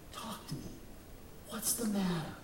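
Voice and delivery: soft, hushed and caring; gentle. Acted. Jesus speaks softly to weeping Mary, gentle. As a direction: coax her softly to open up. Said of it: "He comes to her gently".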